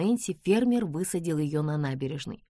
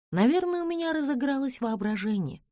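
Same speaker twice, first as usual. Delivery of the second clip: much higher, unsure of herself; high doubtful voice; 10 semitones higher than usual. Acted. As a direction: talk herself out of the worry, pitched up. Nancy thinks aloud, doubting herself.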